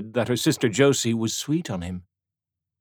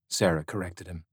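plain reading voice

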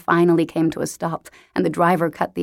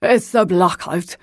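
put-on slow Highland Scottish accent; slow Highland burr, rolled R's; 3 dB louder than usual. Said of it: "in a thick Highland accent".

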